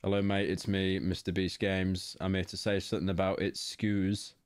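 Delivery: put-on lightly British-accented read-out. Streamer reading a viewer's message aloud, lightly British accent. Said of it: "it sounds more British".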